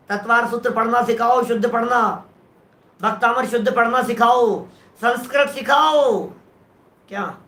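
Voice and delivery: usual speaking voice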